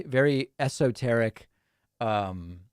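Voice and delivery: normal talking voice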